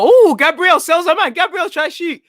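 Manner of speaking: rising with the attack, genuinely hyped; excited. Real, not acted. Fan commentating as Gabriel breaks forward, excited burst.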